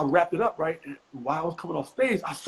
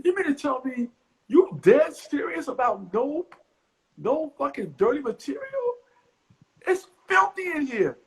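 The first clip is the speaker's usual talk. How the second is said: indignant; 12 semitones higher than usual. Retold or imitated. He argues back indignantly.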